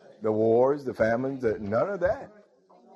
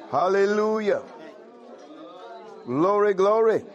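exultant praise; 7 semitones higher than usual. Real, not acted. Preacher crying out praise to his congregation, exultant. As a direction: ring it out high and full, glory glory.

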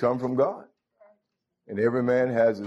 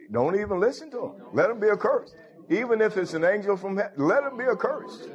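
rising preaching fervor; 7 semitones higher than usual. Acted. Preacher quoting Paul to the church, rising fervor. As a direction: pitch climbing high on accursed. Preacher climaxes on Paul's curse.

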